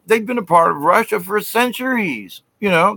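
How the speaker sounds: usual speaking voice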